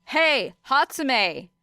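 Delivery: drawn-out call across the crowd. Acted. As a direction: call her name from a distance. Calling out across the field.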